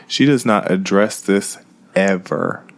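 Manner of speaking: ordinary talk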